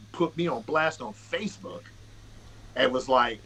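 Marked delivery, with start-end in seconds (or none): none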